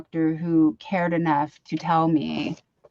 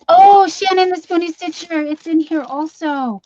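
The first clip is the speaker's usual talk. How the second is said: high, loud burst of delight; delighted exclamation; 9 semitones higher, 6 dB louder than usual. Real, not acted. Opening a friend's gift box, delighted and loud. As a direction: gasp out the name with joy.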